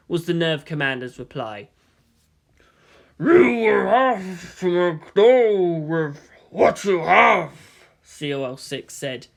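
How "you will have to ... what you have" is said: slow, much louder commanding voice; stern elder. Acted. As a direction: lay down the order heavily. SEELE member voice.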